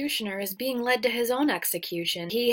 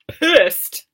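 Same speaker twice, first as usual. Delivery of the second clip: loud punchy pissed; loud emphatic pissed; emphatic shout; 5 semitones higher, 11 dB louder than usual. Real, not acted. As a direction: punch the word out. Villain's rage described.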